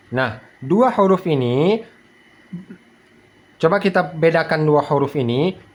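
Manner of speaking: ordinary talk, nothing marked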